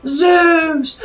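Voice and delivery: high, loud, drawn-out mock wail; cursing. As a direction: curse each word up at the sky. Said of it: "she curses up at Zeus".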